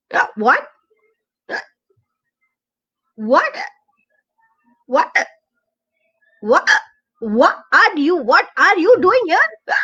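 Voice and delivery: stammering in surprise; startled, tripping over words. Acted. Duckling startled by hidden frog.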